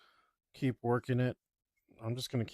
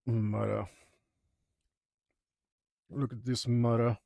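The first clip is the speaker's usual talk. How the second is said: New York accent. Shown as a demonstration. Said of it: "my character's accent".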